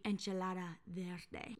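enchilada verde said in a Spanish accent; lightly Spanish-accented menu words; Spanish pronunciation. Shown as a demonstration. Showing cohost how the menu reads, Spanish accent. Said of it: "it would be".

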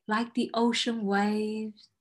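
slow, soothing meditation guide; guided meditation. Acted. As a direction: ease them into calm breathing. Leads a grounding exercise.

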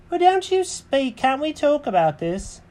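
very high-pitched cartoon cat; Jenkins questioning. Cat questions the birds about his house, very high voice. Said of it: "And he said".

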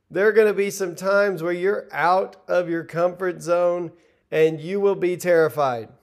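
ordinary delivery

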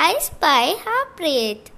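plain reading voice

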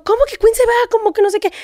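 imitating shocked followers; shocked public. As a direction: mimic the crowd in disbelief. Everyone reacts to post.

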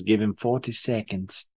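bad, quiet Australian accent impression; terrible Australian accent. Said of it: "It was a terrible Australian accent".

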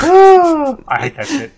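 loud, high, slow outcry; exaggerated disgust. Real, not acted. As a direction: groan it out big and high. Exaggerated reaction to monkey paw.

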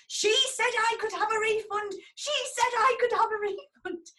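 high, loud mock tantrum; high, loud mocking imitation of a ranting man; shrieking customer. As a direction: squeal it out like a furious customer waving a recorder. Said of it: "held this dictaphone up in the air".

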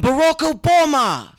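punchline shout. Acted. Punchline of the list.

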